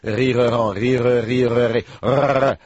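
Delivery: "rire" with exaggerated rolled French r's; vocal warm-up. Translator warming up before a call, rolling "rire". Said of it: "let me just warm up a little bit".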